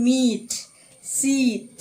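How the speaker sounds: "meat" and "seat" with long held ee; prolonged vowel. Shown as a demonstration. Teacher giving long vowel examples, "meat", "seat" drawn out. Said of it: "in a prolonged manner".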